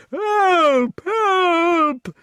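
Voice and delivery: very high, loud panicked squeal. A narrator's reading. Giant bitten by the beast, high panicked squeal. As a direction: squeal for help in terror. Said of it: "squealed the giant".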